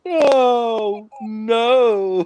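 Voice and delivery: high, loud overacted cry; stilted old movie melodrama. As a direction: ham up the anguished no. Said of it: "Mark Hamill does overacted a bit".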